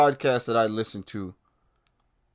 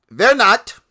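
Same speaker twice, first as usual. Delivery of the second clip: sarcastic; 11 semitones higher, 10 dB louder than usual. Real, not acted. Sarcastic rebuttal of pandemic claim.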